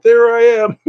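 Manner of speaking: put-on old guy in ad. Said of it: "and it's like".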